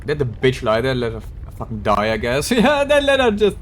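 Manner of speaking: normal talking voice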